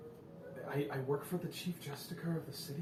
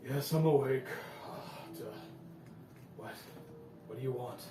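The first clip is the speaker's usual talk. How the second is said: male prisoner voice. Acted. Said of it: "a male voice say".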